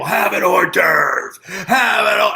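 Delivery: slow, drawn-out mock holler; mock drunken scream. Friend retelling Joey at a party, mock screaming. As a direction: holler it like a drunk pestering guests. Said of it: "Joey's screaming at people".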